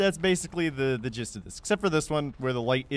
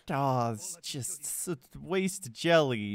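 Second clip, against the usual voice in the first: slow, drawn-out mock pity; hammed-up regret; mock lament; much slower than usual. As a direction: stretch it into a pouty moan. Acted lament over jelly.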